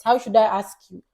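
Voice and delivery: normal talking voice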